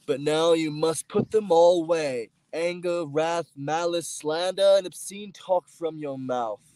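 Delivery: declaimed Bible verse; scripture recitation. Acted.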